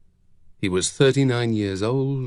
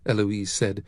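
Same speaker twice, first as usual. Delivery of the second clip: usual speaking voice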